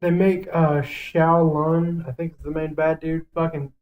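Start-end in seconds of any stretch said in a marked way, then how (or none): none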